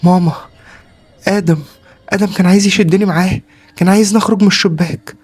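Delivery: put-on high, loud panicked child voice; acted frightened wail to mother; trembling, tearful child. A narrator's reading. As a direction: blurt it out like a terrified little boy. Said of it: "كان بيترعش جامد ودموعه نازلة".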